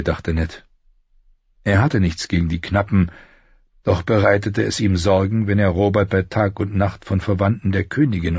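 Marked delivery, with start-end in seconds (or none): none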